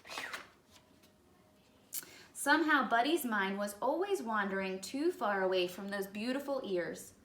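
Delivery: plain reading voice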